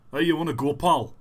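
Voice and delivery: loud Glaswegian challenge; Glasgow accent. Shown as a demonstration. Glaswegian daring someone to fight, broad Glasgow accent. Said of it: "I can do more Glasgow".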